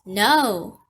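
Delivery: loud, curt refusal; bellowing. A narrator's reading. Ove answers the unseen visitor, bellowing. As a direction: bellow it back through the door. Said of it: "Ove bellowed".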